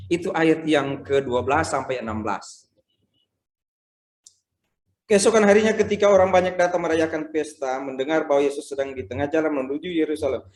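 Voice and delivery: ordinary talk, nothing marked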